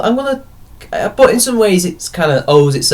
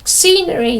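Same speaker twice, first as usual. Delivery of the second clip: much higher, mock-outraged quote; mock social-justice complaint; 13 semitones higher than usual. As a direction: imitate an indignant complainer, pitched way up. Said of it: "as a social justice kind of thing, you know, like".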